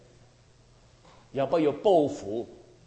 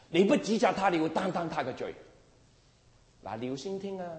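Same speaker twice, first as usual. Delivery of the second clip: ordinary talk, nothing marked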